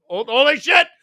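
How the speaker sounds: jaw-drop exclamation; stunned shout. Real, not acted. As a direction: yell it in shock. Another big gift arrives.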